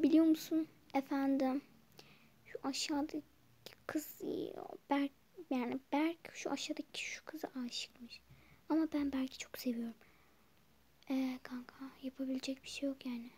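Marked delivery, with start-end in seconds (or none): 2.4-13.3 a soft, secretive whisper; gossip whisper. Acted. Girls whispering in class about Berk's crush, soft gossip whisper. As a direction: tell the secret so nobody hears.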